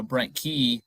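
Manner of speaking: ordinary delivery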